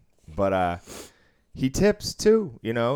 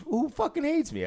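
pitched far higher in mock disbelief; mock indignant squawk. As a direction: shoot the question up high like an offended gambler. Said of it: "he goes".

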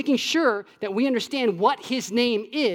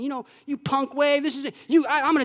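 taunting; 6 semitones higher than usual. Retold or imitated. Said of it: "I'd start taunting the wave".

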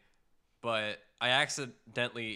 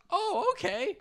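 high, lilting mock-trusting reply; naive acceptance; 6 dB louder, slower than usual. Narrator quoting friend's girlfriend being tricked, high naive acceptance. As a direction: imitate someone sweetly taken in.

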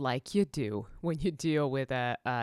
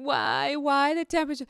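whiny puppy voice; 9 semitones higher, 4 dB louder than usual. Pretend. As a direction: plead as a miserable little puppy. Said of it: "this poor puppy going".

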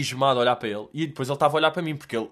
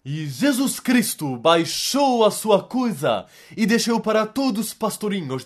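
slow, higher, loud preacher proclaiming; street preacher; 7 semitones higher, 4 dB louder, slower than usual. Retold or imitated. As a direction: boom it out like a street preacher reading the Bible. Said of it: "ele tá tipo". (pregador de rua a gritar devagar e mais alto)

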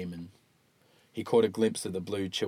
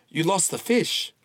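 dry joke; 8 semitones higher, 4 dB louder than usual. Acted.

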